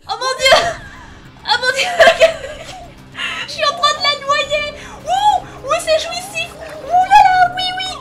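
very high, loud ecstatic squeals; shrieking glee. Real, not acted. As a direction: squeal with wicked delight. Gleeful drowning a victim.

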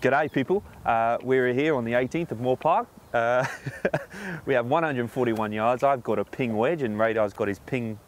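put-on Australian announcer accent, a bit South African. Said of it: "It wasn't bad, for an Australian, sort of South African sort of accent".

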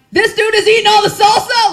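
high, loud mock outrage at the husband; wife catching him eating all the salsa, yelling; outraged shout. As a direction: shriek it in disbelief, half laughing.

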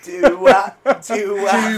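put-on loud, high English-accented echo; mock English accent. Echoing the friend's line, mock English accent. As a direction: parrot it in a hammed-up English accent. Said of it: "I do bad English accents".

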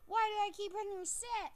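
loud, frustrated repeated shouts; real yelling. Real, not acted. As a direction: yell at the game in frustration. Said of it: "I'm actually yelling right now, really loud".

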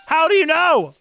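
high, loud disbelieving protest; reenacted amazed outburst; incredulous outburst. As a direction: demand how it could know. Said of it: "I was like".

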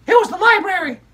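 put-on high, bright child puppet storyteller; squeaky kid character excitedly telling a story; high-pitched puppet girl narrating.

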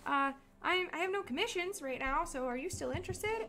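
lifted, tentative customer-service voice; acted message. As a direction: act out a polite, high-voiced message. Acts out messaging a client.

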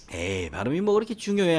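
dismissive question pitched high; dismissive scoff. Retold or imitated. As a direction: imitate someone brushing off its importance. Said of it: "하는 분들이 있어요".

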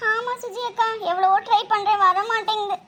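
high, weepy complaint; tearful. Acted. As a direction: answer helplessly, on the verge of crying. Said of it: "அதுக்கே அழுகுற". (அழுகையுடன் உயர்ந்த குரலில் புலம்பி)

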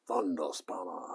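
whispered repeat of the name. Real, not acted. Whispering the mate's nickname to himself. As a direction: breathe the name to yourself.

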